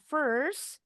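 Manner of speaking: "First" lifted at the end, then held off; comma pause. Shown as a demonstration. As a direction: brake briefly after "First" with a rising tone. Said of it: "pakai koma itu ngerem sebentar tuh, ada jedanya". ("First" nadanya naik, lalu jeda koma)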